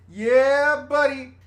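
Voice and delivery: put-on loud, high party-boy catchphrase; hyped reality-show shout-out; Jersey Shore catchphrase. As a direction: belt out the drawn-out "Yeah, buddy". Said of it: "You know, from Jersey Shore".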